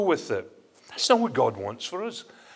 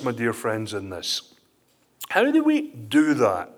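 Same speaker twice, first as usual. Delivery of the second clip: ordinary talk, nothing marked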